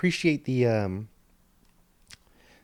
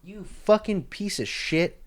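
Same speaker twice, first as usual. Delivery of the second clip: angry outburst; 8 semitones higher, slower than usual. Real, not acted. Ranting at the quarter guy.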